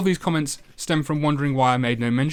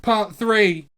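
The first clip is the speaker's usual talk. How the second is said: high announcer call; title announcer; 8 semitones higher than usual. Acted. Host announcing the next part, high announcer voice.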